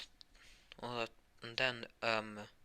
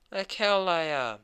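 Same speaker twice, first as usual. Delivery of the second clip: higher and louder, spitting out like hell; furious denial; 6 semitones higher, 6 dB louder than usual. Acted. Ost furiously denies lying to Morse. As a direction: rage at the accusation.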